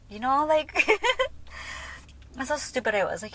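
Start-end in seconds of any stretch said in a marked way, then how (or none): 0.8-2.3 real breathy burst of laughter; amused laugh at herself; self-mocking laugh. Real, not acted. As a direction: laugh at your own foolishness. Laughs at her own stupidity.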